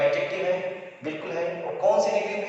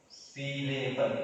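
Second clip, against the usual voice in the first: slow term demo; 3 semitones lower, 5 dB quieter, much slower than usual. Shown as a demonstration. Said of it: "syllable students use बोलते हैं".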